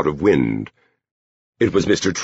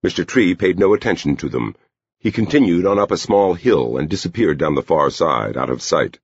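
plain reading voice

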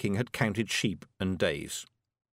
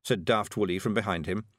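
plain reading voice

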